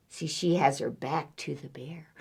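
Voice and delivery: ordinary delivery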